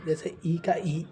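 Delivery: ordinary talk, nothing marked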